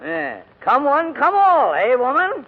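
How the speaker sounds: sarcastic. Acted. Sarcastic mock invitation.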